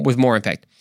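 ordinary talk

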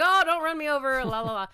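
high, loud, sing-song greeting; a cheery singsong hello over the driveway; chirpy neighbor greeting. Retold or imitated. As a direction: call it out brightly like a passing neighbor. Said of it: "Please tell me you talk in that voice".

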